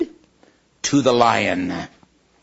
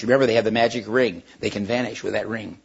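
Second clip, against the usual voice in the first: plain reading voice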